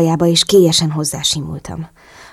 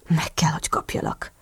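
much softer, whispered growl; animal growl; 9 dB quieter than usual. A narrator's reading. Andrew to Chrissy between kisses, growled whisper. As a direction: breathe it like a hungry animal. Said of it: "morogta állati hangon".

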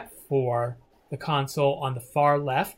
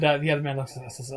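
ordinary talk, nothing marked

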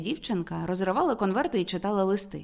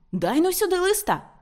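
much higher, urgent excited demand; crying out; 10 semitones higher, 3 dB louder than usual. A narrator's reading. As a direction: demand it at once, pitch leaping up. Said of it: "скрикнув Мартін".

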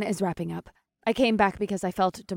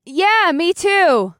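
loud, slow, high shout; deafened yell; 10 semitones higher, 10 dB louder, much slower than usual. A narrator's reading. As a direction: yell like she's half deaf. Deafened woman misreading lips.

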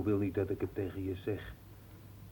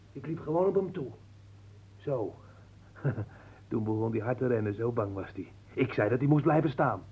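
normal talking voice

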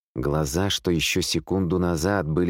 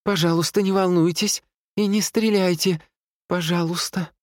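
high-pitched female pleading; pleading nurse; 12 semitones higher than usual. A narrator's reading. Wounded nurse begging armed men, high and pleading. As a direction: beg them in a high voice.

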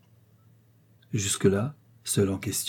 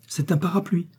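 small, high-pitched shy confession; bashful; 8 semitones higher than usual. A narrator's reading. Mother confessing her modest wish to her daughter, bashful. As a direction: admit it humbly, pitched up. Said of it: "fit l'humble créature en rougissant".

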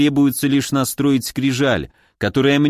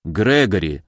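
low, restrained address; dry; 6 semitones lower than usual. A narrator's reading. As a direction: say the name drily, pitched down. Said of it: "сухо сказал Оливер". (сухо)

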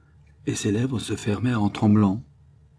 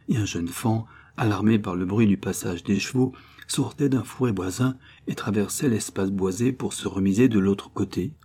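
ordinary delivery, nothing marked

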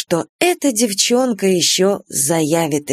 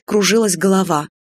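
plain reading voice

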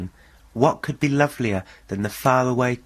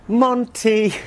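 the unseen neighbour's cat call, much higher and cheerful; imitating the stranger's call; 12 semitones higher, 5 dB louder than usual. Mysterious neighbour aping his call, high and carefree. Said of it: "And the voice went".

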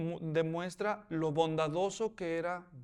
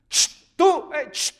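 high, loud psst, you there; sharp acted hail to get attention; calling out; 9 semitones higher, 10 dB louder, much slower than usual. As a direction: call someone over from afar. Acts Booz calling her over.

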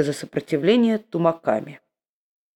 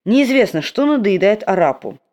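plain reading voice

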